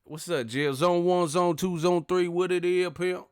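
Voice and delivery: high, loud hyped call-out; Wilder impression. Imitating Wilder's zone call-out, high and loud. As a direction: shout it like a fighter's catchphrase. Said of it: "I say".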